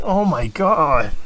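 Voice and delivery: high, slow exasperated oh my God; exasperated groan. Retold or imitated. Dad seeing the second kid sick, slow exasperated groan. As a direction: drag it out in disbelief. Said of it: "I'm like".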